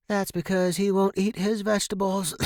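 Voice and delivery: high, voiced-up mom; high-pitched mother explaining away her son; mother's voice. A narrator's reading. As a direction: answer back like a smoker mother. Mother's voice, high, coughing after.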